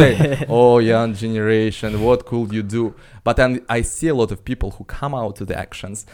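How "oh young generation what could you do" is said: lightly voiced babushka. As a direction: sigh it out like an old grandmother, mildly. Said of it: "as a grand babushka".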